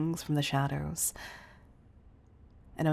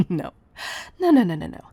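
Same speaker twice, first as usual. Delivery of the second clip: vehement refusal; 2 semitones higher, 8 dB louder, faster than usual. Acted. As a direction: burst out in emphatic denial. Refusing to stand by and watch.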